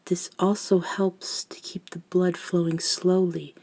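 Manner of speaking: ordinary delivery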